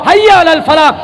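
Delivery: usual speaking voice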